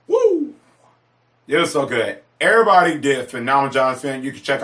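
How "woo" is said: high, whooping cheer; excited woo. Retold or imitated. Fan leaving the theater, whooping high with joy. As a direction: whoop like leaving a great movie. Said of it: "I left out just like".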